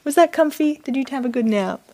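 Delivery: high-pitched cooing to a pet; pet talk. Real, not acted. Owner greets pet after a nap, high doting coo.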